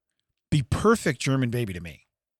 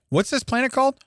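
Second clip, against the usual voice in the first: mock explorer; 11 semitones higher than usual. Explorer voice in planet bit.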